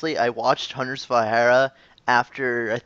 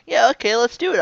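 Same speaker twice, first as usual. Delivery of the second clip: high-pitched, chirpy mock agreement; imitated eager reply; 10 semitones higher, 4 dB louder than usual. Host mimics guest accepting the interview, high-pitched eager voice. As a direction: pitch it right up as a breezy yes.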